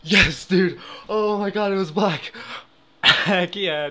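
loud, high cheering; real excited shout. Real, not acted. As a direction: celebrate the win at full voice. Win after returning.

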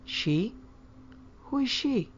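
puzzled, high-pitched query; startled questioning. A narrator's reading. Mother catching daughter's slip, startled.

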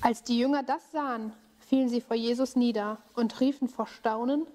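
calm storytelling voice; story narration for children. A narrator's reading. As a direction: tell the tale to kids gently. Bible story told to children.

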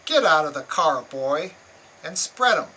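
loud, harsh police command; harsh order. Retold or imitated. Police officer ordering a driver out, loud and harsh. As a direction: bark it at him like a hostile cop. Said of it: "in a harsh tone said".

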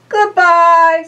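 high, loud goodbye; bright-toned Santa calling goodbye; cheerful Santa farewell. Acted. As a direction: call it out from the balloon. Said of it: "said Santa".

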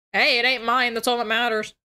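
ordinary delivery

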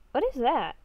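normal talking voice

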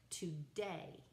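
today with the stress on DAY; stress on second syllable. Shown as a demonstration. Teacher showing students iambic feet, today stressed on the second syllable. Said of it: "the accent is on the second syllable".

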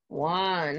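ordinary talk

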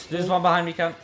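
loud urgent warning; urgent callout. Real, not acted. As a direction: call out the enemy sharply. Team callout during a fight.

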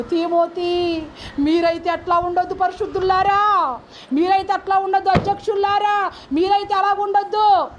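high, insistent exhortation; preaching plea. Acted. Apostle pleading with Timothy and the believers, high preaching exhortation.

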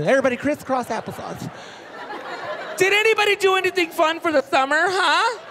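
high, bright-volume teacher voice; chirpy teacher. Retold or imitated. Teacher asking class about summer, chirpy. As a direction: address the class like a kindergarten teacher.

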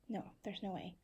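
ordinary delivery

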